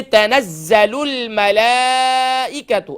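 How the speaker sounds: slow melodic chant; Quran recitation. Shown as a demonstration. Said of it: "تو تنزل ال". (قرآن کی تلاوت)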